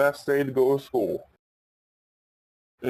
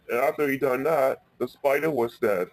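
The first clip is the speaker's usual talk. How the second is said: ordinary delivery, nothing marked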